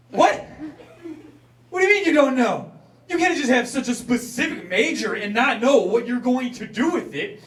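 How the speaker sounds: high, loud, exasperated scolding; indignant rant. Retold or imitated. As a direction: scold her in a shrill, outraged voice. Ranting at Vanessa in parents' voice.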